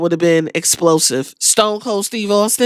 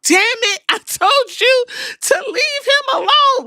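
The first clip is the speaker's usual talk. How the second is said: squeaky, much higher mock-exasperated yelp; frantic manager voice cracking up high; hammed-up Heyman losing it; imitating Paul Heyman. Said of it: "Paul Heyman is like".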